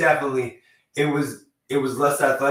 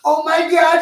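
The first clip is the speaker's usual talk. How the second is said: much higher, louder mock shock; mock gasp; 8 dB louder, slower than usual. Mimicking people shocked by [name]'s comeback, high squeal. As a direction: squeal it like a stunned fan. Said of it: "these people are like".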